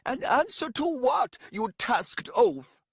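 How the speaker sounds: high, loud, slow scornful shout; woman shouting. Acted. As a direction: shriek the insult at him. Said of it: "now shouted".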